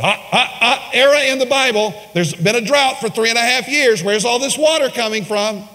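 high, mock-objecting voice; Bible skeptic. Skeptics questioning the Bible's account, exaggerated objection. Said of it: "people who question the Bible said".

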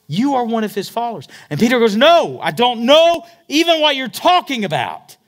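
high, loud acted denial; fiery preacher voicing the accusers and Peter; preaching at full force. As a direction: hurl the words out from the pulpit.

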